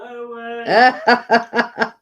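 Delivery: a wild, chaotic burst of laughter. Shown as a demonstration. Announcing it to the viewers, then a chaotic laugh. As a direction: let rip a chaotic cackle on cue. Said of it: "I'll do chaotic laugh".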